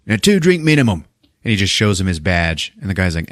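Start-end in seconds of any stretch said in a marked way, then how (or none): 0.1-1.0 higher, chirpy doorman voice; a touch of pushy club doorman; mock doorman. Doorman demanding the cover charge, higher and chirpy. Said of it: "the doorman is like".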